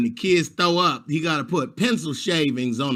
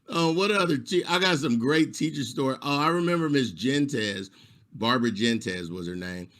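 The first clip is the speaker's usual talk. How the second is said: normal talking voice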